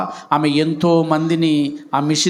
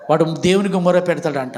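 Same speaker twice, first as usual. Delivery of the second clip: ordinary talk, nothing marked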